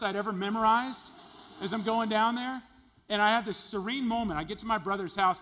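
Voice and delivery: loud, high, driving sermon build; full-force preaching. Acted. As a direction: preach it out, rising to a cry.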